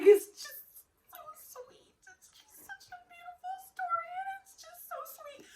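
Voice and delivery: imitating herself crying over a sweet story; mock tearful gush. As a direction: choke it out like a touched crier. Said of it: "sitting there crying".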